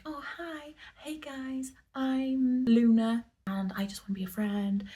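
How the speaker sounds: a hint of a bubbly dog introducing herself. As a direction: play the happy dog greeting you. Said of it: "if the dog is like".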